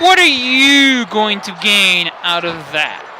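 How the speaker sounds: slow, drawn-out question; slow emphatic questioning. Real, not acted. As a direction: stretch the words out in bafflement. Repeats question slowly for emphasis.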